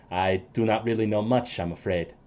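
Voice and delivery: low, soft, apologetic; lightly voiced regretful ally; NPC voice. Ally answers the sister, low and soft.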